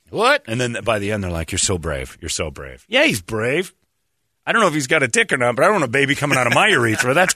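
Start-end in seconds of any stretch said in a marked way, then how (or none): 0.1-0.4 mock disbelief. 1.5-2.8 soft, lower, syrupy reassurance; hushed mock sympathy; mock tender praise. Mocking the show's doctors.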